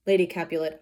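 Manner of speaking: usual speaking voice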